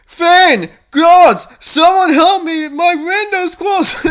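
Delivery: high, loud screaming for help; desperate shout for help. Acted. Princess dangling from the window screams for help. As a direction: yell from the ledge for anyone to hear.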